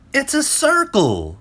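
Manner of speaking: very high, loud excited cry; exclaiming. Acted. Friends amazed as Euclid draws the shape. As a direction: burst out with discovery. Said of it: "they exclaimed".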